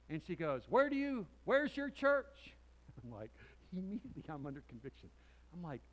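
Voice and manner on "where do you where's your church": high, loud mock holler of a question; raised voice imitating an eager asker; imitated calling out. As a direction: call after someone, hammed-up. Voices woman calling after him.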